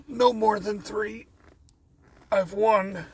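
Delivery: high, slow yawned words; yawning. Real, not acted. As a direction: talk through a yawn. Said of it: "Sorry, the yawn".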